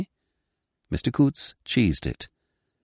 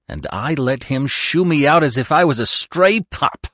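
gritted-teeth mutter; through clenched teeth; 7 semitones higher than usual. Acted. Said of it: "he muttered through clenched teeth".

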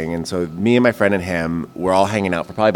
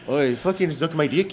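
put-on Irishman voice, still high; Irish man; 8 semitones higher, 3 dB quieter than usual. Shown as a demonstration. Showing friend how an Irish man talks, mock Irish accent. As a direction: try a rough-and-ready Irish accent. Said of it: "like a man, like a".

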